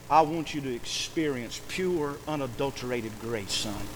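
soft, low and unhurried; acted father's quiet, earnest answer; tender fatherly reassurance. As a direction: gently settle a son, dropping low. Father gently answers his son.

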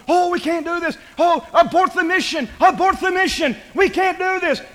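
high, loud crowd protest; loud grumbling. Acted. Preacher voicing the people refusing the mission, loud grumbling. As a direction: pile up the panicky complaints. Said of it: "it got loud, and they were grumbling and mumbling".

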